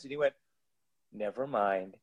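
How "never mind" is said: much lower and softer, deflated; imitating Bill's quiet climb-down; sheepish retreat. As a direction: let it drop, embarrassed. Bill backs down, embarrassed.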